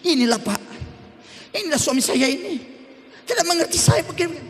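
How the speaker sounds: high, put-on whiny wife voice, rising; complaining wife. Wife complains to the pastor about her husband, high whiny voice. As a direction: whine to the pastor like an aggrieved wife.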